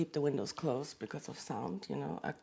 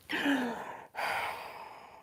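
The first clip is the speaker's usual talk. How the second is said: sigh; 7 semitones higher than usual. Real, not acted. Overwhelmed by anger and hurt.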